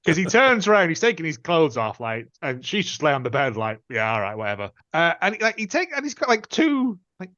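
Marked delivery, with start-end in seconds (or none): 3.9-4.7 low and softer, flatly indifferent "whatever"; mild bored brush-off; bored shrug. Retold or imitated. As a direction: toss off "all right, whatever" as if nothing matters. Repeats her bored reply.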